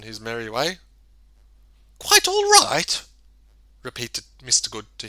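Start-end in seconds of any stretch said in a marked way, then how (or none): 2.0-3.0 high, incredulous echo; astonished question. A narrator's reading. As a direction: repeat it as if you can't believe it. Mister Good repeats in disbelief.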